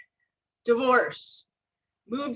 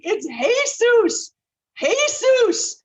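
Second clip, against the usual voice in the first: very high, loud, slow, Spanish-style "Jesus" said as "Hey-soos"; screaming the name; 12 semitones higher, 6 dB louder, much slower than usual. Retold or imitated. Assistants screaming at her phone-call mix-up, delighted high yell. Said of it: "my assistants are screaming".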